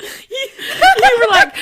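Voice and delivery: slow, high, laughing; tearful laughing wail; laughing crying. Real, not acted.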